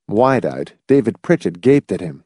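plain reading voice

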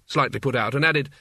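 ordinary delivery, nothing marked

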